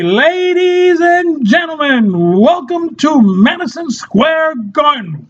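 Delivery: high, drawn-out arena announcement; big mock ring-announcer call. Shown as a demonstration. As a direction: stretch it out like a fight announcer. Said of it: "A ring announcer".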